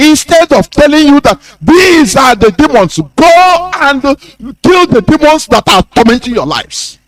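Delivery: high-pitched, strident rallying cry; impassioned shouting. Real, not acted. Speaker rallying listeners against the demons tormenting them, impassioned shouting. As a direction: shout it high, urging people to act. Angry tirade urging listeners to act.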